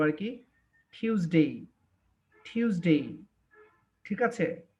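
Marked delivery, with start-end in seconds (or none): 0.9-1.7 টিউসডে with the t aspirated like ঠ. Shown as a demonstration. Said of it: "টটাকে একটু ঠ উচ্চারণ করবো". 2.5-3.1 টিউসডে with the t aspirated like ঠ. Shown as a demonstration. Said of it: "টটাকে একটু ঠ উচ্চারণ করবো".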